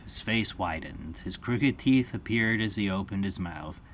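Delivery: plain reading voice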